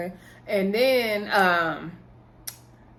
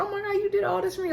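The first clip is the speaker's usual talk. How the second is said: much higher, fast gushing; touched delight; 11 semitones higher, much faster than usual. Retold or imitated. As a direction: squeal it like a touched date. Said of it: "she was touched".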